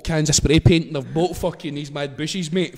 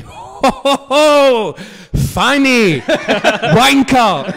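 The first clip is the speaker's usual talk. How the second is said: loud, high-pitched heckling at the telly; big drawn-out whoop, then shouted insults; jeering shouts; 9 semitones higher, 10 dB louder, much slower than usual. Retold or imitated. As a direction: yell abuse at the screen like a rowdy viewer. Acts out jeering at his telly.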